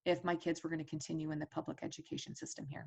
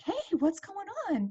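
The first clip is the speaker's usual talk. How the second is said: surprised greeting; 12 semitones higher, 3 dB louder than usual. Retold or imitated. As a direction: pitch jumps high on a startled hello. Surprise phone call from Deborah.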